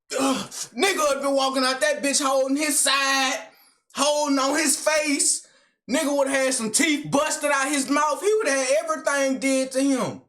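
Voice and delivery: high, emphatic, worked-up voice; heated boasting. Real, not acted. Viewer vowing the attacker would have been busted up, worked up. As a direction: insist on it, all fired up. Reactor heatedly describes damage he'd do.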